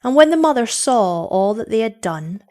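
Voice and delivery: plain reading voice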